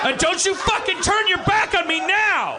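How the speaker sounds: angry shout. Acted. Hitler screams at his wife in the bunker, furious. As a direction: yell at her, pitch way up.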